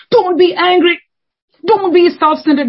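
put-on high, loud scolding of a child; nagging parent. Parent scolding the kids, high and loud. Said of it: "Then they'll go after their kids".

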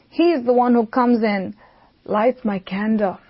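ordinary delivery

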